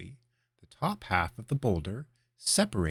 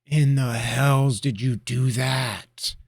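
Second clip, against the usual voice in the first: awed apprentice; 5 semitones higher, 9 dB louder, much slower than usual. Acted. Apprentice awed by boulder trick.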